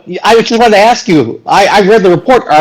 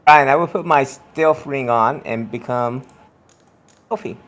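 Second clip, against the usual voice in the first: much softer, lower, sneaking; sneaky hush; 7 semitones lower, 7 dB quieter than usual. Pretend. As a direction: murmur it like slipping away unseen. Player whispers stealth move.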